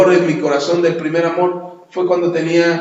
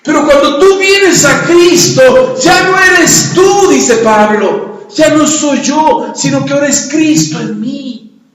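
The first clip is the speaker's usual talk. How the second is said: forceful preaching; 9 semitones higher, 8 dB louder than usual. Acted. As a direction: preach it out at full force to the church. Preaching loudly to congregation.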